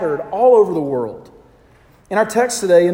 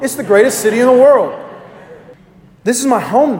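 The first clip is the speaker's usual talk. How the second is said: higher and louder, pushed faster; louder, emphatic; 5 semitones higher, 5 dB louder than usual. Shown as a demonstration. Pastor rousing the congregation to agree, loud and emphatic. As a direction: say it again like you mean it, rallying the room. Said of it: "come on".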